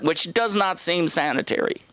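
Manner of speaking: ordinary talk, nothing marked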